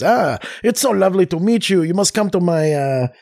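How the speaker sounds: high, gushing fake French accent; over-the-top French accent. Pretend royal inviting a new acquaintance, mock French accent. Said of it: "We keep talking to them in this".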